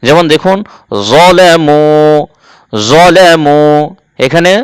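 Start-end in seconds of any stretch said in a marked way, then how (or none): 1.1-3.6 'জলেমু' opening on a thick, rounded 'zo'; heavy z. Shown as a demonstration. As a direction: recite 'জলেমু' drawn out, heavy 'zo' first. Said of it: "জ হবে".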